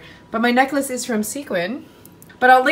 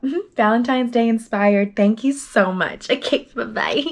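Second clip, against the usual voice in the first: ordinary talk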